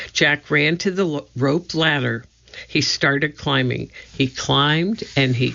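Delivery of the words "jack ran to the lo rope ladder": ordinary delivery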